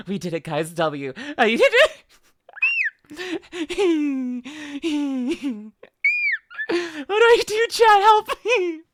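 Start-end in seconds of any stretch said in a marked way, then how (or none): 1.4-8.8 high, loud pleading to chat; overwhelmed, begging for help; flustered squeal. Real, not acted. Streamer asks chat for help, high and flustered.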